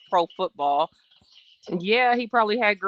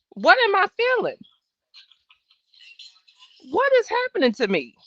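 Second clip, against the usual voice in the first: much higher, alarmed cry; mock panic; 12 semitones higher than usual. Reliving pregnancy shock in a mock panicked voice. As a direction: act out being freaked out.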